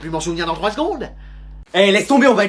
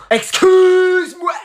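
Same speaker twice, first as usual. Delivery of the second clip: high, long, drawn-out outrage; shocked disbelief; 10 semitones higher, 6 dB louder, much slower than usual. Acted. Gamer stunned that his friend plays on ten screens, drawn-out shock. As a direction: stretch it out in total disbelief.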